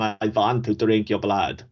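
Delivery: slow, hammed-up Transylvanian count accent; mock Eastern European vampire. Podcaster mocking the cartoon, slow hammed-up Eastern European vampire accent. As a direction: ham up a Dracula-style Eastern European accent, slowly. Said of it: "So go with full on".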